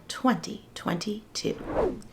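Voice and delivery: fading into an unvoiced whisper. Real, not acted. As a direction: whisper the last word of the date. Whispered end of the date.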